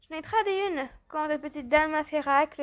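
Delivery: ordinary talk, nothing marked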